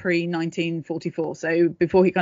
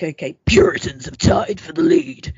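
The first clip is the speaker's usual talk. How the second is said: high, louder mock announcer call; game announcer voice; 9 semitones higher, 4 dB louder than usual. Host voices a Halo announcer calling the lead, high and loud. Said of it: "I just made a Halo reference".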